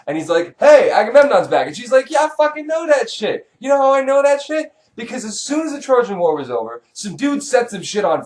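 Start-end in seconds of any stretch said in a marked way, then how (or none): 0.6-1.7 loud, higher-pitched call; herald's announcement. Acted. Herald telling the queen her husband is home, announcing. 2.1-4.7 high-pitched, know-it-all retort; impatient queen. Acted. Queen answering the herald, impatient.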